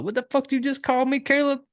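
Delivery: high, quick confrontation, acted out; mock indignant challenge. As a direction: call him out in put-on disbelief. Voices the offended girlfriend's challenge.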